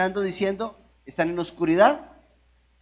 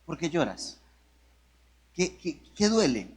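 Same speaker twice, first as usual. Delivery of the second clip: soft sincere question; 3 semitones lower, 4 dB quieter than usual. Real, not acted. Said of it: "En serio".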